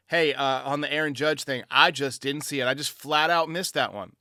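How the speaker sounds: ordinary delivery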